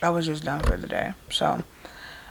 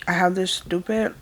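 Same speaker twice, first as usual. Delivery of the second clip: usual speaking voice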